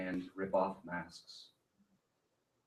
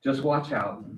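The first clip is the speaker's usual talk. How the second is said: Just watch out said louder and higher; louder retake; 5 semitones higher, 8 dB louder than usual. Real, not acted. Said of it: "I have gum in my mouth".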